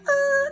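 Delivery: very high, loud skid noise; imitated tire screech. Dreamer skidding a shopping cart, high imitated screech. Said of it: "and I skirted".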